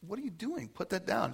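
alarmed uncle. Acted. Uncle walks in on niece with hand in vase, alarmed. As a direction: play an uncle caught off guard.

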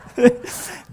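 high bursts of laughter; real laugh. Real, not acted. Pastor laughs at his son's fireworks oohs.

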